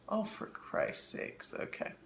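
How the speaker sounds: breathy whispered exasperation; whispered mutter. Real, not acted. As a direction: mutter it under your breath. Muttering at her own mix-up.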